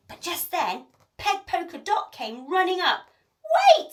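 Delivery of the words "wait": very high, loud cry; urgent cry. A narrator's reading. Peg to the pirates at the plank, urgent loud cry. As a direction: stop everything with one shout. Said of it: "she cried".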